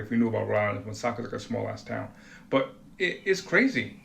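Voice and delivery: normal talking voice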